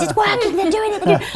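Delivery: high, loud squeal of glee; excited mock scream. Telling friends the prank call works, high excited squeal. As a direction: squeal it like a secret triumph. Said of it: "screaming on the phone".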